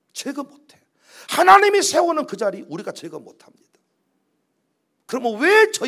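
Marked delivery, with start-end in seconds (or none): 1.3-3.6 emphatic preaching. Real, not acted. Preacher repeats the point louder.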